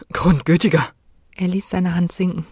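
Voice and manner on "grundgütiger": startled gasp. Acted. Startled by sudden appearance.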